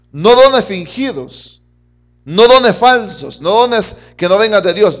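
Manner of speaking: slow, loud, hammered warning; emphatic preaching. Acted. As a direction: drive each phrase home slowly. (advertencia lenta y enfática)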